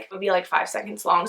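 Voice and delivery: ordinary talk, nothing marked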